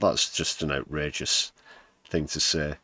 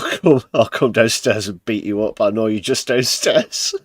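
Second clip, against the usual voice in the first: translating the threat, louder and laughing; hammed-up tough guy, cracking up; laughing through a playful mock threat; 4 semitones higher, 5 dB louder than usual. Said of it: "Vengo giù, ti prendo a pugni".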